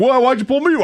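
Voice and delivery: high-pitched imagined complaint; mock indignation. Driver challenging the officer over the stop, mock indignant.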